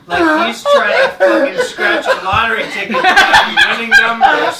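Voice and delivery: loud, high, laughing through the story; laughing while telling. Real, not acted. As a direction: crack up while retelling it. Retelling absurd class story laughing.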